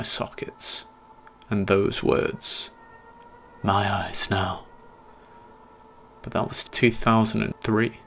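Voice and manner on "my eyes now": slow, hushed echo of the attacker; remembered menace. Retold or imitated. Narrator recalling his attacker's words, slow hushed echo. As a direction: recall it like a haunting memory.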